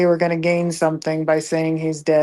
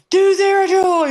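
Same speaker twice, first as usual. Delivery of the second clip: high, eager mock request; imitating eager boys; 11 semitones higher, 4 dB louder than usual. Boys begging him to do a game voice, imitated eagerness. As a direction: plead like an excited fan.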